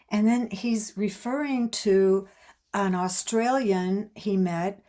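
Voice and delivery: usual speaking voice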